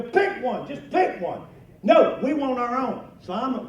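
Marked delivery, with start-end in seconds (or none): 0.0-1.5 louder, higher insistence, pick one pushed hard; insisting. Retold or imitated. Exasperated grandpa insisting. 1.8-3.0 put-on high, loud, slow whiny child refusal; stubborn kids. Grandkids refusing, voiced loudly.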